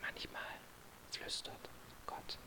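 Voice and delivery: barely audible whisper, almost no voice. Retold or imitated. Pastor whispers the answer to the desperate man, a soft whisper. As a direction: breathe it out as a secret. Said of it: "Und der Pastor flüsterte".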